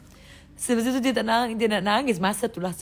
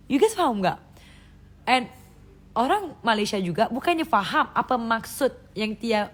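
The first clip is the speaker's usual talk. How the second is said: ordinary talk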